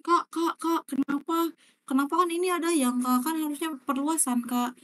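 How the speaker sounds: high, pleading student voice, calling Kak over and over; mock student whining at the teacher; whiny student asking. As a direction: play a confused pupil tugging at the teacher. Voicing a student's chat question.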